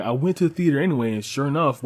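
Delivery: normal talking voice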